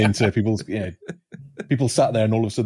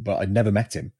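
ordinary delivery

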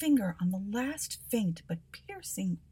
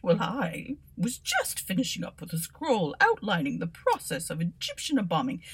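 nervous scholar; 3 semitones higher, 3 dB louder than usual. Acted. Bobbins voiced in story.

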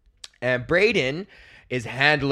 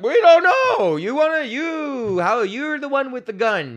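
much higher, squeaky mock disbelief; mock incredulous high pitch; 11 semitones higher, 5 dB louder, slower than usual. Comic sassing young cop, high mock disbelief. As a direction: throw it back at the cop. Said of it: "I'm like".

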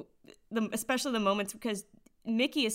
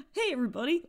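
higher cartoon character voice; lightly goofy, pitched-up greeting; a hint of a cartoon dog; 7 semitones higher, faster than usual. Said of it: "very exact scene of just like".

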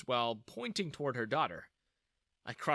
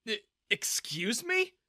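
stunned; 9 semitones higher than usual. Acted. Stunned at guard's insult.

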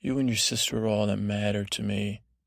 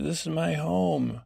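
higher-pitched mock teen voice; teenage protest; 5 semitones higher than usual. Teenage girl objecting to being moved, mildly mock-indignant. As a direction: imitate a teenager standing her ground. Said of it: "So as a teenage moment".

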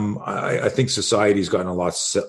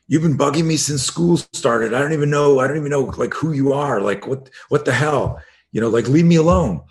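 higher-pitched retold teen protest; indignant; 6 semitones higher, 3 dB louder than usual. Student standing up to his bully, indignant. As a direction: act out a boy finally standing up for himself.

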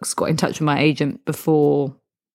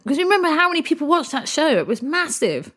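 excited; 10 semitones higher, faster than usual. Real, not acted. Excited rhetorical aside.

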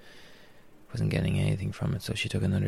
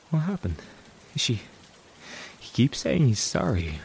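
ordinary delivery

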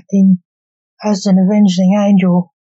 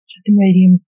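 plain reading voice

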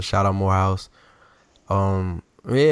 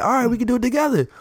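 eager quick reply; 13 semitones higher, much faster than usual. Retold or imitated. Speaker's own quoted reply.

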